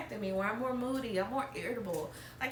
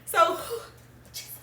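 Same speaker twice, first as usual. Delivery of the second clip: crying; 10 semitones higher, 7 dB louder than usual. Real, not acted. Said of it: "I hate crying".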